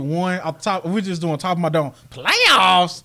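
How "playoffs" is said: mock incredulous coach rant; loud disbelieving "Playoffs?"; incredulous press-conference echo; imitating Jim Mora. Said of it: "best soundbite ever".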